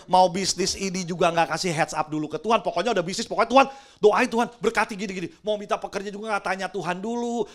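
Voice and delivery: ordinary delivery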